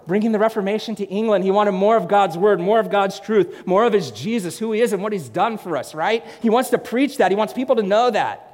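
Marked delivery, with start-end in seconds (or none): none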